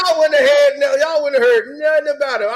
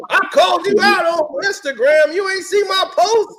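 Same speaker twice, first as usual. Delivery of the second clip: mimicking a trash-talker, raised pitch; higher-pitched mock complaint; mock indignant call-out; 6 semitones higher, 3 dB louder than usual. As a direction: act out an indignant challenger. Acting out social media challengers.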